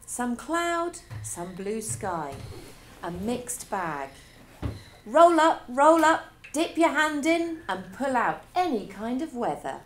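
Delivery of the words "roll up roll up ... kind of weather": loud, high barker's call to the crowd; booming carnival hawker pitch; fairground barker. Acted. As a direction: roll them up like a showman at the fair.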